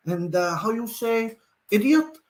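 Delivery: mock foreigner groping for the English word; Steve McLaren's pretend foreign accent. Streamer imitating a manager in Holland, pretend foreign accent. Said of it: "like Steve McLaren pretending he doesn't know the English".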